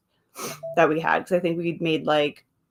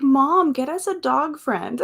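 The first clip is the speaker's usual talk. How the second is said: a high little bird voice begging Mom; lightly voiced birds asking for a dog friend; pleading birds; 7 semitones higher, slower than usual. Voicing her birds asking Mom for a dog, high and pleading. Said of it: "They're like".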